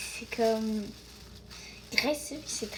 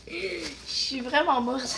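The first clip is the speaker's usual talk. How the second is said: slow drawn-out; 2 semitones higher, 4 dB louder, slower than usual. Real, not acted. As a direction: stretch each word slowly. Drawn out, laughing.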